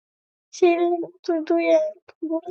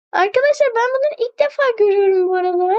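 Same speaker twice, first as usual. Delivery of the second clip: higher, fast and laughing; giddy, laughing excitement; thrilled; 4 semitones higher, much faster than usual. Real, not acted. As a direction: gush about it, rushing the words. Thrilled by new room.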